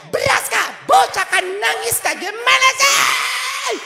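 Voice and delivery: very high, loud, slow scolding screech; shrill nagging scream. Retold or imitated. Wife shrieking at her just-woken husband about rice, very high and loud. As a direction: scream it at him, hammed-up fury.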